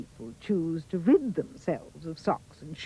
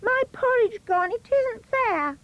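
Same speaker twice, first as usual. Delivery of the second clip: put-on crying baby bear. A narrator's reading. Baby bear crying to his father, high wail. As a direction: wail it like a tiny upset cub. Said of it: "cried the baby bear".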